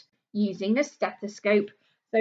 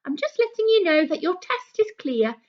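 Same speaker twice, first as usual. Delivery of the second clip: higher, brighter phone-call doctor voice; a cheerful doctor calling with good news, higher; 11 semitones higher, 4 dB louder than usual. Pretend. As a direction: play the doctor ringing a patient, louder and higher. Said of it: "they phone them up and say".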